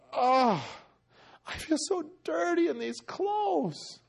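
acted anguished confession; distressed pleading. Acts out Norman repenting, disgusted.

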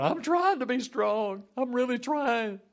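high, whiny pretend effort; mock straining. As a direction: ham up someone struggling hard to obey. Mimics believer straining to be strong.

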